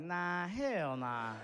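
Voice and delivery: very slow, stretched denial; drawn-out refusal. Retold or imitated. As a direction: drag out each word in mock disbelief. Acted refusal to the big guy.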